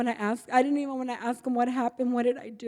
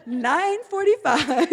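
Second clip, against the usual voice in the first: laughing; 7 semitones higher, 6 dB louder, slower than usual. Real, not acted. Laughs at how early it was.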